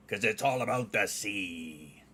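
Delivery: hammed-up pirate punchline; playful pirate. Streamer delivering a dad joke to viewers, playful pirate. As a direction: land the pun as a mock pirate, drawing out the last word.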